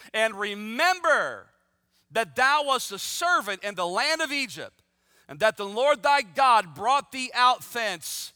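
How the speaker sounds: ordinary talk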